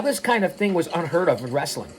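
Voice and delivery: ordinary talk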